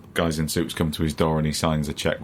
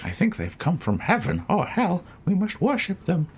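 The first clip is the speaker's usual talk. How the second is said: high-pitched, hammed-up worshipper; high voice of a parody cult convert; mock religious zealot; 13 semitones higher than usual. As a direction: play a comically awestruck believer, pitched way up. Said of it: "someone's having a religious experience".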